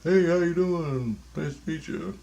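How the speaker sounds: high-voiced imitation of greeters; friendly strangers greeting. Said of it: "people are coming and saying hi to me".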